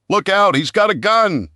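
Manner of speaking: high, loud panicked cry; frantic shout of alarm; screamed warning. A narrator's reading. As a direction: scream a warning about the gun. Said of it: "a man screamed".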